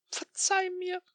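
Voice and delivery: high, broken sob into a sleeve; sobbing apology to a lost friend. Acted. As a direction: plead for forgiveness through tears. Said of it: "schluchze ich in meinen Ärmel".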